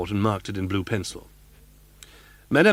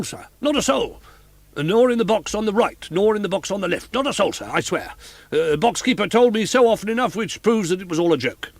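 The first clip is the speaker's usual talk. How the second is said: anxious inspector; 11 semitones higher, 4 dB louder than usual. A narrator's reading.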